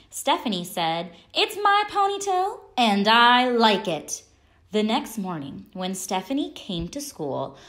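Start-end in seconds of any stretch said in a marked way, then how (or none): 1.3-4.2 put-on defiant child. A narrator's reading. Stephanie defiant to kids.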